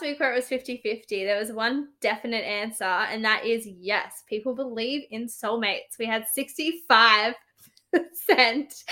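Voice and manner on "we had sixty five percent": lightly voiced talk show host. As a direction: announce it like a TV host. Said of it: "I'm talking like a talk show host".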